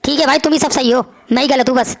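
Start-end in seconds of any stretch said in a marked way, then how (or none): none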